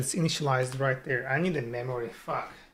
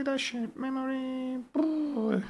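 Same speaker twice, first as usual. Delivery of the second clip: "shared memory" sung out much higher; high playful voice; 9 semitones higher, 6 dB quieter, slower than usual. Shown as a demonstration. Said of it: "repeats the words playfully".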